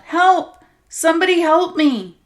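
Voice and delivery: desperate scream, high and loud; a beaten woman screams for rescue; yelling for help. Acted. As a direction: scream for anyone to hear. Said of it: "She took a breath and yelled".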